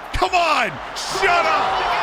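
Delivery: high, loud cry of disbelief; real high-pitched celebration shout; ecstatic goal shout. Real, not acted. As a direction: yell it out as the goal goes in. Celebrating a beautiful team goal.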